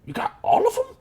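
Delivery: put-on very high, wide-eyed child goblin; little goblin boy. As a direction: pipe up like an amazed small child. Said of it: "three to four-year-old goblin boy".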